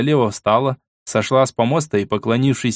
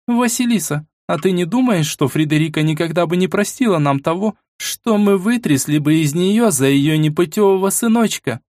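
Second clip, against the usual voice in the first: much higher, gently reasoning woman; female voice; 7 semitones higher than usual. Acted. Irina voiced high.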